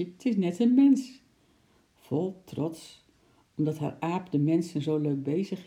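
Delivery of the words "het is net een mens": high, loud, amused exclamation; mother exclaiming. A narrator's reading. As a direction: exclaim it loudly in a raised voice. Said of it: "riep de moeder van het kleine meisje". (luide, verrukte uitroep van een moeder)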